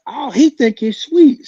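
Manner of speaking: high, loud, slow scoff. Retold or imitated. Teller scoffing at the rival to his friends, high loud sneer. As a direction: sneer it, disbelieving. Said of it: "I said, I said".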